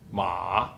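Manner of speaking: "Ma," low, dipping then rising; "Ma," in a drawn-out low dip; third tone. Shown as a demonstration. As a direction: let "Ma," sink, then curl up. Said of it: "a falling and rising tone".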